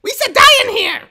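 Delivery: very high, loud cartoon yelp; squealed panic at full volume; Jar Jar. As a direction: shriek it in a goofy alien voice. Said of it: "I love Jar Jar".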